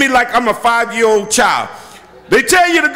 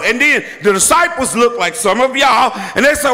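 ordinary delivery

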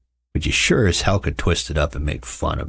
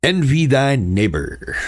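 drawn-out, higher, slow quote; mock commandment; 7 semitones higher, slower than usual. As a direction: intone it slowly like scripture. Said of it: "sit there and be like".

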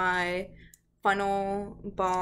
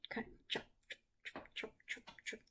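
voiceless, very soft snipping words; whispered chopping; 19 dB quieter, much faster than usual. Pretend. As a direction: whisper it like little scissor snips. Acts out shearing the sheep.

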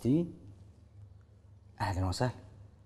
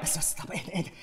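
softer, breathy pleas to calm down; hushed calming; 4 semitones lower, 7 dB quieter than usual. Acted. Father quietly tries to calm his furious son. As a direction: soothe him in a hurried hush.

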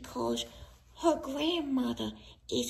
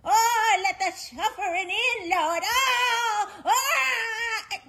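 high, hammed-up howls to heaven; sing-song mock agony; dramatic wailing; 5 semitones higher, slower than usual. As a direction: wail in theatrical suffering. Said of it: "Quit with the dramatics".

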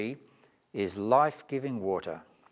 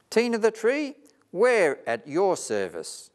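cheerful chorus; 9 semitones higher, 4 dB louder than usual. Acted. Animals cheer together.

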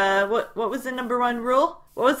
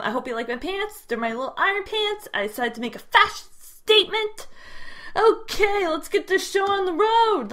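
ordinary talk, nothing marked

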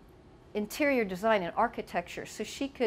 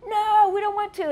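put-on high, loud childish whine; mock kid protest, pitched way up; 12 semitones higher, 8 dB louder than usual. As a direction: shout it back like a stubborn kid refusing. Kids refusing bath.

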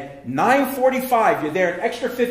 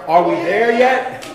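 louder, drawn-out whine from the back seat; impatient kid; 3 semitones higher, 9 dB louder, slower than usual. Retold or imitated. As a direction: whine it like a bored child. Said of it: "What is something the kids always say".

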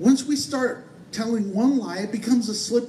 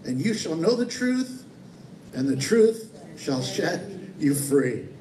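scripture recitation; 3 semitones lower than usual. Acted. Quotes scripture solemnly.

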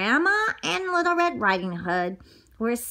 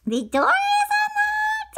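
put-on old granny calling. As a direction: call out like a frail old granny. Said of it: "said Granny".

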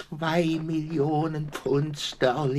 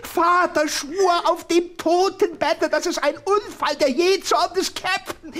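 shrill-free but high, loud, desperate denial; frantic high protest; 6 dB louder than usual. Acted. Thaddeus swears to the detective, loud and high. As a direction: cry out in horror to defend the father. Thaddeus defends dead father, shouting.

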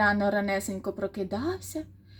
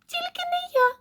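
very high tiny-mouse squeak; teeny, high-pitched mouse voice. A narrator's reading. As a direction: squeak the refusal out. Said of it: "пропищав Круть".

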